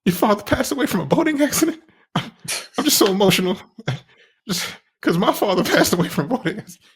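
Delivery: mock emotional crying. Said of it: "He's like".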